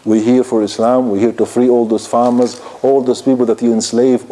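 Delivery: ordinary talk, nothing marked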